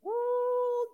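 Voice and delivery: a sudden high falsetto ooh of praise; shrill-high joyful ooh; ecstatic high ooh. Real, not acted. Preacher exclaims in worship.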